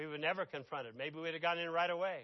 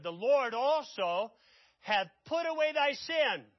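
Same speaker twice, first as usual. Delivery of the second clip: loud proclamation; 6 semitones higher, 5 dB louder than usual. Acted. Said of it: "And Nathan said unto David".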